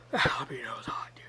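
hushed, breathless whisper. Real, not acted. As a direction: whisper it under your breath. Whispered aside about heat.

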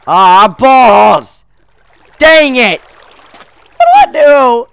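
loud, high cries of frustration; drawn-out frustrated shout; exasperated yelling. Real, not acted. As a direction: yell in exasperation at the game. Frustrated at failing in game.

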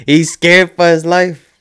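stretched-out, stunned exclamation; emphatic, disbelieving repeat. Real, not acted. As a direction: say it again bigger, hammering scared home.

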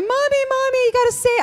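put-on high, excited little girls' voices; high childish plea for help; kids calling for mom. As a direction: imitate the panicked little girls calling mommy. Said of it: "the girls come running in the house going".

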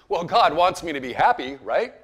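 imitating a naive old man, loud and pleading; loud mock plea of an old churchgoer. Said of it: "he goes like this".

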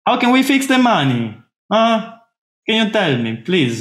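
high-pitched theatrical appeal, falling at the end; mock Italian pleading. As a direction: beg the spy, hammed up. Acted Italian pleading with the spy.

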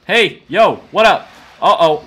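ordinary talk

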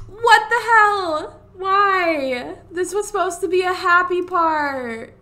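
loud, high wail of dismay; loud, distressed outburst; shocked protest. Real, not acted. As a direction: cry out in upset disbelief. Pet dying, player protests in shock.

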